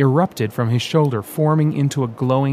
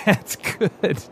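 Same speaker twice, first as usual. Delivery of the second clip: laughing through the words; amused at the joke; chuckling approval; 8 semitones higher than usual. Acted. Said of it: "David laughed".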